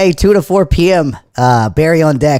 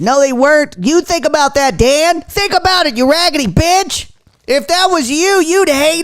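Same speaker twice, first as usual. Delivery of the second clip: much higher squeaky helium rant; 11 semitones higher than usual. Real, not acted. Streamer on helium lecturing Dan, squeaky and indignant. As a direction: scold Dan in a chipmunk squeak.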